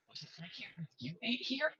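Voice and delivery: high, put-on squeak of disbelief; mock incredulous voice. Tara mocks the cowboy's restaurant, high and teasing. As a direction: tease him in a silly high voice.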